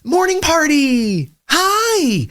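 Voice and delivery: very high, drawn-out gleeful squeal; Morning Dave. Acted. Morning person teases sleepy partner, squealing high. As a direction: greet like an overexcited early riser. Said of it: "This is what morning Dave is like".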